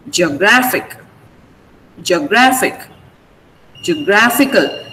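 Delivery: geographic and geographical with GRA stressed. Shown as a demonstration. Teacher models geographic words, stress held on GRA. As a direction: lean on the second syllable, geo-GRA-phic, geo-GRA-phical. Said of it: "the stress remains on gr".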